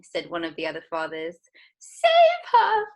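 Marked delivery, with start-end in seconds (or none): 1.8-2.9 shrill, very loud cry; cried. Acted. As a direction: beg desperately. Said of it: "cried Missus Beauregard".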